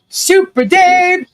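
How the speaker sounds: high, loud, drawn-out shout; child's yell. Retold or imitated. A five-year-old yelling at Travis, a high drawn-out yell. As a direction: call it out across the street. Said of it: "by yelling".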